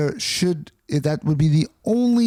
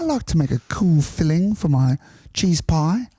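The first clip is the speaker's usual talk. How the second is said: higher mock British baker; comic proper-English baker, pitched up; proper English accent; 4 semitones higher than usual. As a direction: present it like a British baking-show contestant. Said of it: "they're proper".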